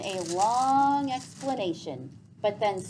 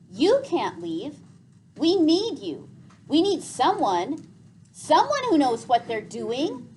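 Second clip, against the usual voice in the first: higher, louder, insistent plea; desperate pleading boy; 6 semitones higher, 7 dB louder than usual. A narrator's reading. Gregor pleading with Vicus not to leave them. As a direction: hold him back with your voice.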